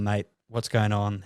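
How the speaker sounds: ordinary talk, nothing marked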